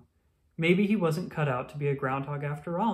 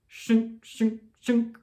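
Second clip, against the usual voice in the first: high, clipped mock shovel sound; shoveling noise; 8 semitones higher, 4 dB louder, much slower than usual. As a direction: voice the shovel scraping.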